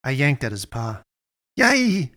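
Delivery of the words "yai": high, sudden yelp; much higher, louder startled cry. A narrator's reading. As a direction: jump at the yank. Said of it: "he said".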